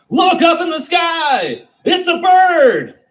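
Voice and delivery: high, slow, loud yell; first guy in the crowd yelling at the sky; booming excited cry; excited shout. Retold or imitated. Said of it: "he's the one that goes".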